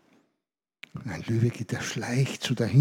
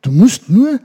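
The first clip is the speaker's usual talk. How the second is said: wise monkey's advice; 4 dB louder than usual. Acted. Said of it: "Und er sagte".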